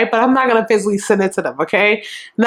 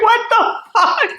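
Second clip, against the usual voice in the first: very high, drawn-out what the fuck; slow, disbelieving outburst; stunned disbelief; 12 semitones higher, slower than usual. Retold or imitated. Boy's stunned reaction retold.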